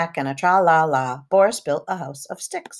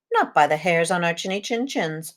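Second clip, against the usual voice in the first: ordinary delivery, nothing marked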